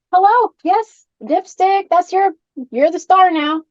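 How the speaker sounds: high, sing-song coaxing; talking to doll. Real, not acted. Talks to her ventriloquist doll.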